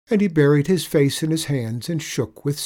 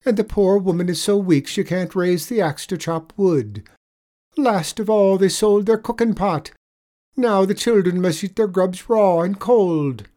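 lightly voiced leprechaun, higher and louder; leprechaun telling a pitiful tale; 9 semitones higher, 7 dB louder than usual. A narrator's reading. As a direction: tell a woeful tale as the leprechaun, raised voice. Leprechaun tells sad tale.

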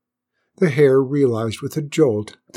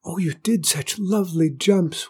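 high, small, admiring flower voice; bashful little admirer; shy whispering flower; 7 semitones higher than usual. A narrator's reading. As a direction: praise shyly in a tiny high stage whisper. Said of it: "whispered the flower".

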